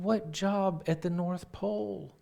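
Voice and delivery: high-pitched, incredulous question; baffled. Retold or imitated. Sister asks brother about his North Pole application, baffled.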